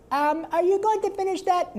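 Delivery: very high, far cartoon nerd voice; Carl's voice. Asking a friend for his food, high nasal kid. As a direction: whine it like the dorky kid Carl. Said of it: "The way I came up with that".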